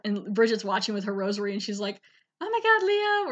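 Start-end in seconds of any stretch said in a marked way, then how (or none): 2.4-3.3 high-pitched imitated cry of shock; mock horror. Wife watching her husband shot, mock horrified.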